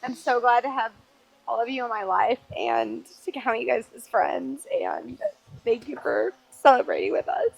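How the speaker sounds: ugly crying. Real, not acted. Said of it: "And I'm done ugly crying".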